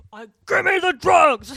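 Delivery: high, loud demonic shriek; crazy demon voice. Retold or imitated. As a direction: scream it like a possessed mother in labour. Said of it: "like, crazy demon voice".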